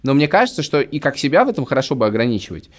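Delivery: ordinary delivery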